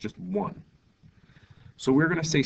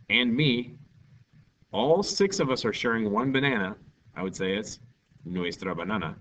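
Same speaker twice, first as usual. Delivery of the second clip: usual speaking voice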